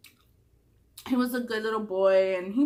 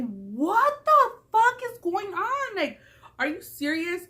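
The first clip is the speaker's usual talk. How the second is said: high, dragged-out stunned cry; much higher, slow disbelief at the ex calling the house phone; shocked outburst; 9 semitones higher, much slower than usual. Retold or imitated. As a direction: stretch it out like you can't believe it. Acted shock at his call.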